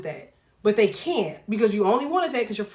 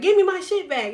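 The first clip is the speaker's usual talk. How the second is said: mock demand; 8 semitones higher, 4 dB louder than usual. Demanding her Cash App back.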